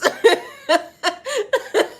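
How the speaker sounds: loud high cackle; high laugh. Real, not acted. Laughing at the thought of rebirth, high and loud. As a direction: crack up laughing.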